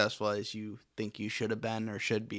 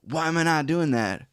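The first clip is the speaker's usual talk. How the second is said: louder, rising rant at himself; worked-up self-talk; 4 semitones higher, 7 dB louder than usual. Retold or imitated. A friend ranting at himself about quitting his job to live in a van. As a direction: talk yourself into a frenzy. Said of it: "And I'm like".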